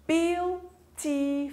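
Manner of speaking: slow syllable count on "Beautiful". Shown as a demonstration. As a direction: stretch "Beautiful" out slowly with gaps between syllables. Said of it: "Olha, são três sílabas".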